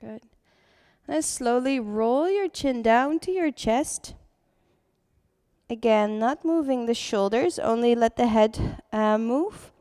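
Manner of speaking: calm voice easing the class into breath; guided meditation. Acted. Leads seated breathing meditation.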